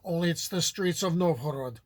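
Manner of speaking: ordinary talk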